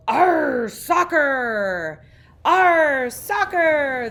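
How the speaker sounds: very loud, high pirate roar; pirate yell. A narrator's reading. The captain kicks the soccer ball, loud pirate roar. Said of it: "kicked the ball and yelled".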